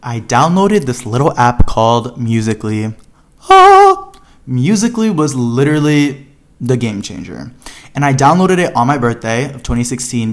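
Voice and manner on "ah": sudden high, loud squeal of delight; squealing with glee; excited squeal. Real, not acted. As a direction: shriek with joy at the memory. Excited about Musical.ly.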